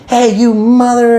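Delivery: high, drawn-out angry holler in imitation; mock scream. Angry man walking up to the car, mock screaming. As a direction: mimic a man storming up hollering. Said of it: "he starts screaming".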